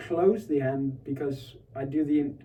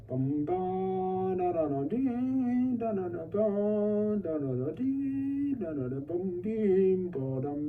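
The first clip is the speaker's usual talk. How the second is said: under tempo; slower than usual. Shown as a demonstration. Student sings the phrase under tempo for teacher. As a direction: sing the phrase slowly, below tempo. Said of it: "maybe a bit under tempo".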